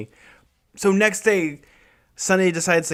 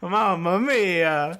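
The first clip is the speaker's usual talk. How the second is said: mock Italian; 2 semitones higher, much slower than usual. Joking Italian stereotype.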